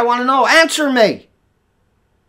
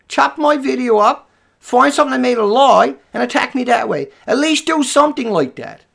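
ordinary delivery

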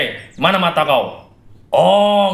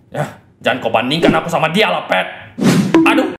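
normal talking voice

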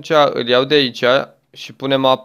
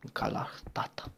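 soft whispered aside; 17 dB quieter than usual. Acted. Quiet joke after the baby dino's roar, whispered.

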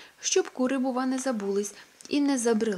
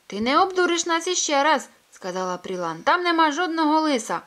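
defiant hen Prilan; 8 semitones higher, 9 dB louder than usual. Acted.

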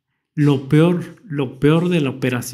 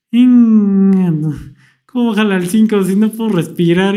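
louder, disbelieving protest; indignant outburst; 4 semitones higher, 6 dB louder than usual. Retold or imitated. Patient protesting the nurse turning down his oxygen, louder. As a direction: object in alarm.

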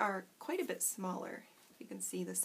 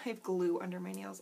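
usual speaking voice

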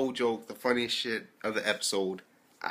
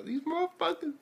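much higher squeal of disbelief; high amused outcry; 4 dB quieter than usual. Retold or imitated. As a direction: squeak it out half laughing. Retelling train onlookers.

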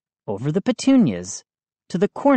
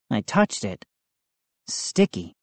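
plain reading voice

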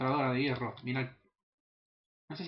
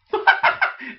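high giddy laugh; 11 dB louder than usual. Real, not acted. Laughs after taming second wolf.

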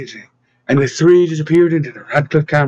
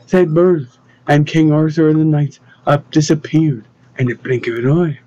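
ordinary delivery

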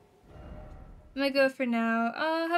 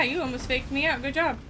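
ordinary talk, nothing marked

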